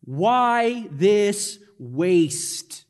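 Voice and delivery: very slow, emphatic retold anger; drawn-out indignant quote; mock anger. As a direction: imitate angry critics, one heavy word at a time. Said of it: "say this in their, their anger".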